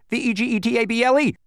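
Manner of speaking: high, loud, hurried letters; rushed spelling. A narrator's reading. Bee spells before time runs out, rushed and high. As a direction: rattle off the letters as fast as possible. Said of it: "he spelled as fast as he could".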